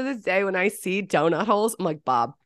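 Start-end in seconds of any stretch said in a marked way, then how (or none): none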